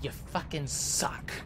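soft, breathy, slow whisper; whispered contempt at the game character; whispered insult. Real, not acted. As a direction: hiss the insult quietly.

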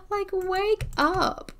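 high, slow coaxing wake up; sing-song wake-up call. Pretend. Coaxing the sleeping husband, drawn-out high wake-up call. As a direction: draw it out to rouse a sleeper.

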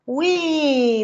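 ordinary delivery, nothing marked

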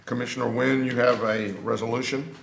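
ordinary talk, nothing marked

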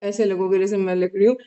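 mask stiff around mouth; talking with mask on; wearing a face mask. Real, not acted. Seen 0: Tissue face mask covers face and upper lip; lips stay visible.

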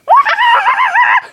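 shrieking high evil laugh; unhinged villain cackle. Acted. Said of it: "Don't speak in an evil voice".

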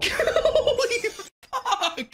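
high scared yell breaking into a laugh; startled laughing yell. Real, not acted. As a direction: shout through fright and laughter. Jump scare from a bass.

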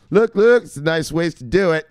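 high, loud "look, look" burst; excited call to look. Real, not acted. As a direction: grab attention, eager. Excited calling attention.

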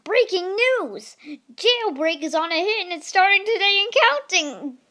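a silly attempt at a seal announcing news. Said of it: "I'm gonna do a seal voice".

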